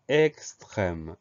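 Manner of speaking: "extrême" drawn out slowly and clearly; deliberate, lengthened "extrême"; slow dictation. Shown as a demonstration. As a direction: stretch "extrême" so a learner hears each sound. New word dictated slowly.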